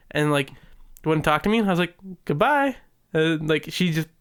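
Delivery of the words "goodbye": a high, drawn-out "Goodbye"; mock singsong goodbye. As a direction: tease her with a lilting farewell. Teasing goodbye.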